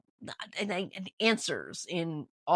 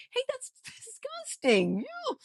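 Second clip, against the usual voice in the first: disgusted; slower than usual. Real, not acted. Recoiling from the rat meal.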